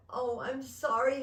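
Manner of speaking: put-on high, loud, upset little-girl apology; distressed child. A narrator's reading. Child to mother who yelled, upset apology.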